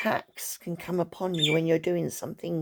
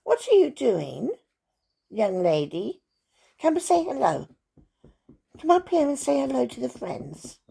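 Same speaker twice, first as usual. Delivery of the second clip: high, loud pet-talk; calling the dog; 7 semitones higher, 5 dB louder than usual. Real, not acted. Calling her dog over on camera.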